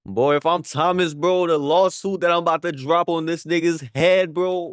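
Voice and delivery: loud, high-pitched riled-up rant; worked-up hype. Pretend. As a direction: get loud about the big payout you're owed. Imagines himself as the victim suing.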